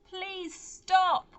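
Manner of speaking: high loud pleading cry; desperate loud high plea. Acted. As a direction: shout it to stop the giants. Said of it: "Greta cried".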